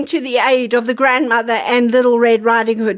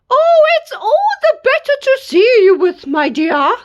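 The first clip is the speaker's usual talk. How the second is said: wolf as granny; 10 semitones higher than usual. Acted.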